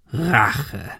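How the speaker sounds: slow hoarse villain echo. Acted. Man in the fog mocking Ben, hoarse villain. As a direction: echo the word slowly in a husky villain's voice. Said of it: "lachte heiser".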